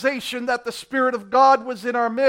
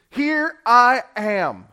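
slow, humble reply; earnest answer; much slower than usual. Acted. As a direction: answer slowly, fully surrendered. Moses answering God.

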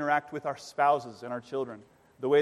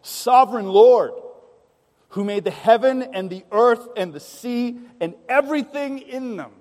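proclaiming prayer; 6 semitones higher, 8 dB louder than usual. Retold or imitated. Preacher voicing the church's bold prayer, loud and ringing. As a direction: declare it to the heavens. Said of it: "No, they said".

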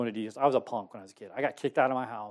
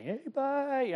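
much higher, breezy throwaway bye; casual mock goodbye; 13 semitones higher than usual. Leaving for basic, brushing off a big goodbye. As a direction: toss it off lightly, no emotion. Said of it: "I didn't want a big emotional goodbye".

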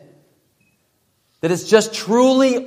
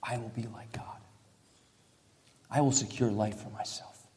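hushed mock pride; 6 semitones lower, 13 dB quieter than usual. Foreparents voiced, whispered.

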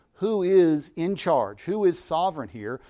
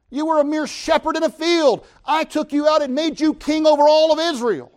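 God's rebuke, much higher and louder; preaching at full force; 8 semitones higher, 7 dB louder than usual. Acted. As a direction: build to a thundering rebuke. Voicing God rebuking David.

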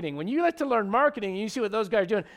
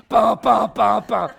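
mock thudding bumps; 3 semitones higher, 11 dB louder, slower than usual. Imitating boat hitting something.

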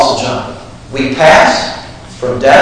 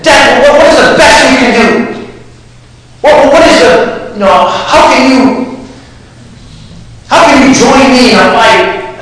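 higher, defiant dare thrown at death; taunting challenge; 8 semitones higher than usual. Retold or imitated. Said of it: "you challenge".

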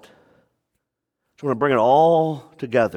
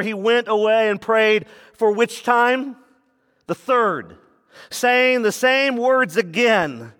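raised, driving sermon voice that keeps building; preaching; 7 semitones higher than usual. Acted. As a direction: preach it to the church with rising force. Preaches the Gethsemane passage.

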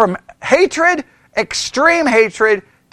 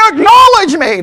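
loud, high mock wrestler's command; bossy demand; 8 semitones higher, 6 dB louder than usual. Brother walking up to Liam, loud bossy demand. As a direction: strut up and belt out the catchphrase.

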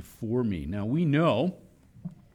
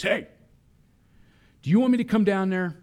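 higher, louder and fast, a warning dad call; stern dad voice; 8 semitones higher, 6 dB louder, faster than usual. Retold or imitated. Dad calling down to noisy kids, stern warning voice. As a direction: call down the stairs like a dad about to lose patience. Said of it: "said in my best dad voice".

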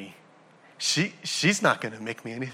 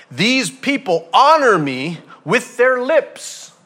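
higher, louder, slow proclamation; scripture proclaimed; 8 semitones higher, 7 dB louder, slower than usual. Acted. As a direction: proclaim the verse big and slow. Quoting scripture at full force.

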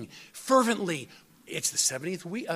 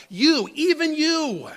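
"you, even you" much higher and louder, slowly; slow, loud, high-pitched "you, even you"; emphatic repetition; 10 semitones higher, 7 dB louder, slower than usual. Acted. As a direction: drive home "you, even you" from the pulpit. Preacher presses the emphatic Hebrew.

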